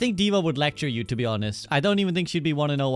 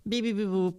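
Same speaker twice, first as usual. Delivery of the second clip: higher, softer robot beeping; playful robot noises; robot beeps; 6 semitones higher, 4 dB quieter than usual. Retold or imitated. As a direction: chirp it like a little robot. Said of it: "I think he'd just be like".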